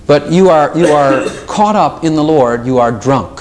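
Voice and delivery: usual speaking voice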